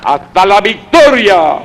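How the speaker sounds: high rousing rally shout; ranting dictator speech. Real, not acted. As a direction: shout the slogan to the crowd. Consignas gritadas al final. (consigna gritada con fervor)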